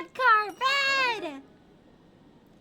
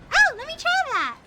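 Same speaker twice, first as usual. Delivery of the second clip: eager; 9 semitones higher, 7 dB louder than usual. Real, not acted. Eager to test trick.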